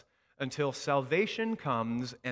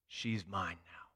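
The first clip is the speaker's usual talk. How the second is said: softer, lower, slow; quiet echo; 5 semitones lower, 6 dB quieter than usual. Retold or imitated. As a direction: let the memory land softly. Remembered words, quiet echo.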